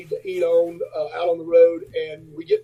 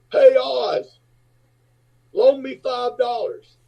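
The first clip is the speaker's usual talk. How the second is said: raised pitch, drawn-out demand; lightly imitated muscleman hailing a little man; big guy calling out; 7 semitones higher, much slower than usual. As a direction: call over to someone across the room. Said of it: "this big, muscled guy walks in".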